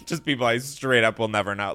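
usual speaking voice

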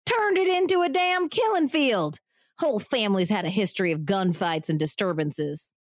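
hammy Texan man; bad Southern accent. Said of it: "bad Southern accent acting".

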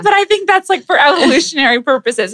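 high, loud, laughing imitation. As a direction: quote him while cracking up. Husband quoted through laughter.

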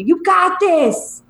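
high, slow tipsy cheerleader; giddy party girl. Retold or imitated. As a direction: pump her up like a drunk stranger. Voicing the bathroom hype girl.

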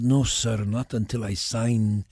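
put-on slow, deliberate Spanish accent. Actor refusing producers who ask him to drop it, Spanish accent. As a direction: hold firmly to the Spanish accent, drawing out the ending. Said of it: "speak to us without an accent".

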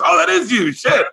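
high, delighted and laughing; older man confirms it's him, high and delighted; delighted realization. Retold or imitated. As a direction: break into a laugh of recognition. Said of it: "He goes".

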